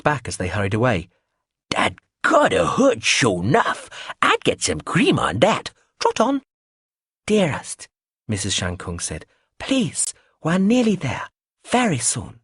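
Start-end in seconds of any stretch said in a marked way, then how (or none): 1.7-6.4 put-on high, slow, batty old dear; sing-song dotty lady; eccentric old lady voice. Biscuitine's eccentric voice. 7.3-7.9 soothing. Acted. Missus Shankung soothing. 9.6-12.4 high, gentle coaxing; calm a fretful friend; soothing. Acted. Missus Shankung soothing Biscuitine.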